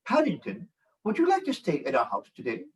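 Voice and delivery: imitating little twins' British accent; quick, mock-British child's echo; children's British accent. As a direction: copy a child trying out British. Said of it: "the two five-year-old twins said".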